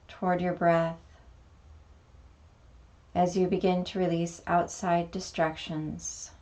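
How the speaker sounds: calm meditation guide, long pauses between phrases; guided meditation. Acted. Teacher guiding beginners through a mini meditation, calm meditation voice. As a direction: lead gently into stillness, unhurried, letting each phrase settle.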